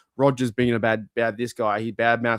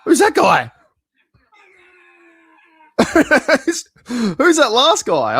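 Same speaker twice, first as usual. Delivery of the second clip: much higher and louder, laughing; laughing at a guy in the clip; amused; 10 dB louder, slower than usual. Real, not acted. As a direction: ask through laughter.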